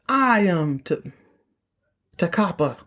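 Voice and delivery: slow, breaking off at "T-" before "Takapa"; halting recall. Real, not acted. Host quoting Jock while recalling the name, halting before "Takapa". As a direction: pause mid-name to work out "Takapa". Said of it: "I have to think of it".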